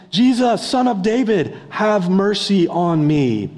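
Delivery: screaming plea, acted out; retold scream. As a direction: call out over a crowd, begging. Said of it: "He is screaming, yelling".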